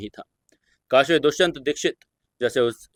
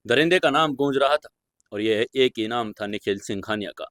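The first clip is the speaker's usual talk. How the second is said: ordinary delivery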